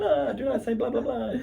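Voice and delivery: high, laughing mock vampire; hammy vampire through laughter. Repeats vampire line, laughing.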